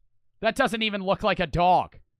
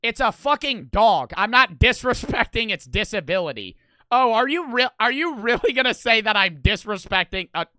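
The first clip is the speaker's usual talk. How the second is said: indignant; 4 semitones higher, 6 dB louder than usual. Real, not acted. Indignant rebuttal to being accused.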